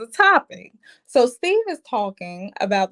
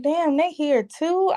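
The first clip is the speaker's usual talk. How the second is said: higher, slow, disbelieving "Damn"; mock surprise; 7 semitones higher than usual. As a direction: act out your own shock. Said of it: "I was like".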